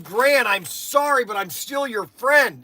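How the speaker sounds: hammed-up sorry, half joking; retold apology. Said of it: "and I said".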